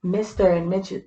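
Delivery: ordinary delivery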